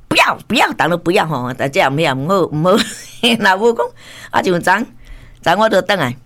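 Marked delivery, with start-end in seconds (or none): 0.1-0.7 high mimicked child's refusal; rebellious toddler. Grandmother showing the grandchild's rebellious phase, mock toddler refusal. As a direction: play a contrary little child saying no. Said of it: "伊就这样叛逆期".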